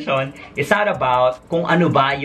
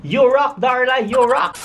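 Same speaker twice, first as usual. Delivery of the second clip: high, bright chant of praise; sing-song cheer to Darla; cheering catchphrase, pitched high; 8 semitones higher than usual. Acted.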